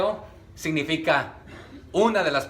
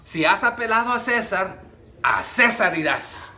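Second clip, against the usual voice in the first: higher start, then a firm falling tune on apelado and César; as an exclamation; 4 semitones higher than usual. Shown as a demonstration. Preacher reads Festo's verdict as an exclamation. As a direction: declare it, falling hard on César. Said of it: "Ya de una manera de exclamación".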